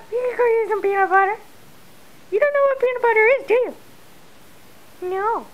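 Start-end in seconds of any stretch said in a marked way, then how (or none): none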